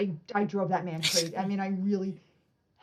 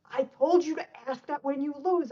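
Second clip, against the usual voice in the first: higher-pitched imitation of an exasperated coach; exasperated instructor; 7 semitones higher than usual. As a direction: retell the scolding with exasperation. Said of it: "he smacked his forehead".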